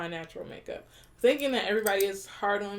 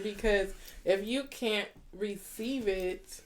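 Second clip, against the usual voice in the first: normal talking voice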